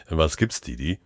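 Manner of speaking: low, soft, settled question; laid-back colleague. Acted. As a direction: ask it easily after a chuckle. Relaxed colleague after laughing.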